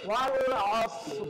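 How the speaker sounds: ওয়াল আসর slow, with the র held still; final ra made silent. Shown as a demonstration. Teacher modelling the stopped ending for the child. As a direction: stop on আসর without a vowel. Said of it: "তখন এখানে এইভাবে হবে".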